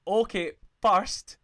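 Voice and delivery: drawn-out loud okay first; host loudly calling order before the debate; loud slow call to order. Real, not acted. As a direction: stretch it out loud to get attention. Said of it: "calling the table to order".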